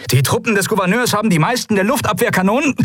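high, fast captain's report; urgent battle report. Acted. Captain reporting on anti-aircraft guns, urgent high rush. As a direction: rattle off the report under fire.

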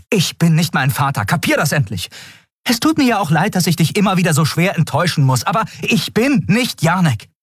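put-on understated furious young captain; lightly angry Endriel. A narrator's reading. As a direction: fire it back at him. Endriel snaps at Kero.

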